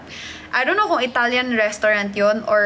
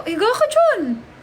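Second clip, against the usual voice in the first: mock coaxing; 10 semitones higher than usual. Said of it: "Sabi ko".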